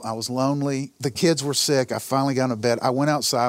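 usual speaking voice

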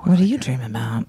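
spooky hushed whisper. Acted. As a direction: breathe it like a ghost story. Spooky echo of the question.

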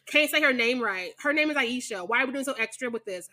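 ordinary delivery

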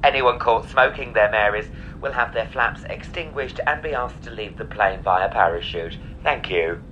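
airline announcement. Acted. Mock in-flight safety announcement.

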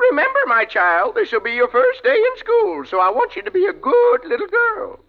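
mock mother. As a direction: play a fussy mama sending her girl to school. [name] plays mother to Francie.

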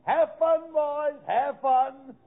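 high, drawn-out sing-song farewell; gleeful send-off. Acted. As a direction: wave them off with mock cheer. Waving off the pair gleefully.

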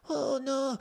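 very high mock falsetto wail; put-on womanish shriek in a mock Bangladeshi accent; screaming little lady. Man mimicking a lion's victim screaming, high mock wail. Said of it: "screaming like a little lady".

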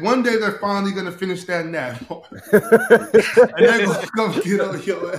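laughing. Real, not acted. Laughing while teasing.

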